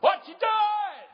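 slow, high shout across the mountain; hollering. Retold or imitated. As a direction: call it out long and loud. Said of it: "get up there and holler".

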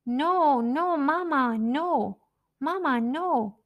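high, loud wailing no, Mama; baby screaming no. Retold or imitated. Baby girl screams as mother hands her to Joe, loud high wail. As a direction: cry out like a toddler torn from her mother. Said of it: "she starts screaming".

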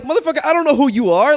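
high, loud exasperated retort; exasperated outburst. Retold or imitated. To the other driver after a dumb question, exasperated. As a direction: throw it at him like a dumb question.

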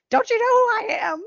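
high, petulant squeal of self-importance; Loki. Acted. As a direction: squeak it out like an indignant little brother. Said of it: "Loki's just always like".

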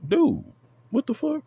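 very high, rising what the fuck; incredulous. Real, not acted. About people doubting his fandom, an incredulous yelp. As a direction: yelp it in disbelief. Exasperated outburst.

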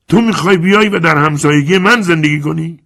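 high, loud alarmed shout. Acted. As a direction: cry out, shaken by the news. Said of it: "اسکالا لرزید و فریاد زد".

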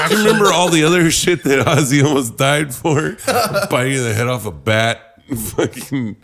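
loud, high, laughing incredulous question; real laughter breaking through loud talk. Real, not acted. Cracking up over [name] mishaps.

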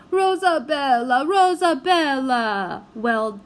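high, loud Italian wail. A narrator's reading. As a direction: wail her name in despair. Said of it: "wailed Signor Madeline".

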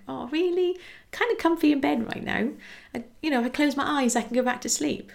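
hammed-up reluctance to leave a comfy bed; reluctant sleepy protest. Herself sleepy, resisting getting up.

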